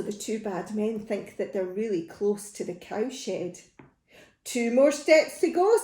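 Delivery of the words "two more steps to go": lightly acted thief, higher and louder; voiced thief Hugh. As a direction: raise pitch and volume for the thief. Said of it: "said Hugh".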